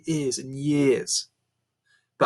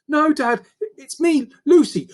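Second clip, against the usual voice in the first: put-on much higher young girl's voice, urgent; pleading girl; 14 semitones higher, 5 dB louder than usual. A narrator's reading. Daughter pleads with her changed dad, higher girl's voice. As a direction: beg him to know you.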